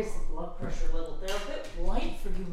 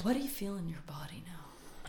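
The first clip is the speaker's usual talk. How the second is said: soft unvoiced whisper; 6 dB quieter than usual. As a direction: ask it in a hush. Whispered question.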